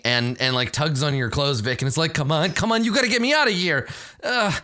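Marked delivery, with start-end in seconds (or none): 2.1-4.5 high-pitched panicky babble, lightly acted; a touch of cornered desperation, pitched high; fervent, fearful pleading. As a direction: beg urgently in a raised pitch. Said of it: "he shouts a little bit more, like, fervently".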